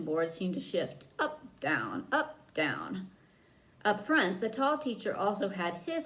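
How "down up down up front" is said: ordinary delivery, nothing marked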